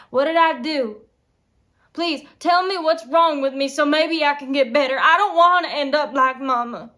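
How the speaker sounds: loud, urgent begging; anguished outburst, very loud; desperate plea. Acted. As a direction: beg for an answer at full voice.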